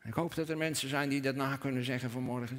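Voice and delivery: ordinary talk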